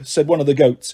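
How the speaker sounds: plain reading voice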